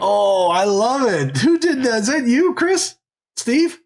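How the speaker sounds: high-pitched, delighted outburst; thrilled, voice shooting up high; delighted surprise. Real, not acted. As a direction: squeal with real delight at a surprise.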